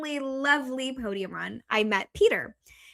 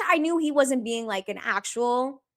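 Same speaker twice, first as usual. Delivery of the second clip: normal talking voice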